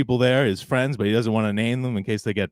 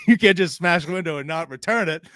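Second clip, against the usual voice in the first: laughing; 3 semitones higher than usual. Retold or imitated. Retelling Punk's line, laughing.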